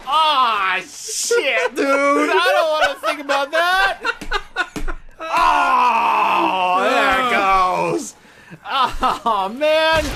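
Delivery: high, loud anguished yell; horrified shout. Real, not acted. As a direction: wail at the thought. Reacting in horror.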